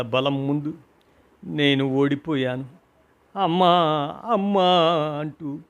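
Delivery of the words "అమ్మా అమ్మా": calling out for mother. A narrator's reading. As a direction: shout for mother again and again. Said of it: "అరుస్తున్నాను".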